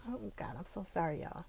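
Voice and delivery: real choked-up apology; holding back emotion. Real, not acted. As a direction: apologize quietly while overcome. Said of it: "I'm not gonna get emotional".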